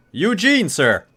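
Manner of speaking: high, loud shout back; yelled over shoulder. Acted. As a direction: call out loudly over your shoulder. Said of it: "They yell out over their shoulders".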